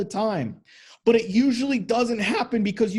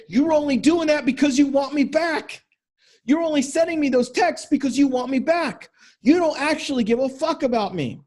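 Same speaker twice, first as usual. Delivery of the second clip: higher, louder accusing retort; scornful wife; 6 semitones higher, 3 dB louder than usual. Retold or imitated. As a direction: throw his gestures back at him, higher and louder. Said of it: "she's gonna say".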